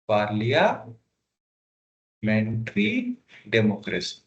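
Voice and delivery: parliamentary democracy drawn out very slowly, every syllable separate; slow dictation. Shown as a demonstration. Teacher to students, dictating the term very slowly. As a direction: stretch parliamentary democracy for note-taking. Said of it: "but it's a parliamentary democracy".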